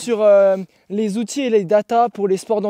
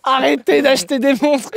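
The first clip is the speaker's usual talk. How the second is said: laughing mock plea; 5 semitones higher, 3 dB louder than usual. Joking plea amid laughter.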